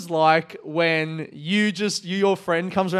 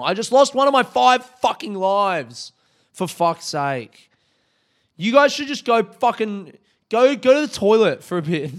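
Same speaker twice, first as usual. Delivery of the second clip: loud, high, exasperated rant; frustrated; 6 semitones higher, 5 dB louder than usual. Real, not acted. Vlogger blaming viewers for a lost life, frustrated. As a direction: blow up at the viewers in mock fury.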